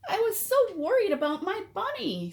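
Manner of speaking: put-on high, anxious little-girl voice; worried little girl. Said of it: "said Sonia".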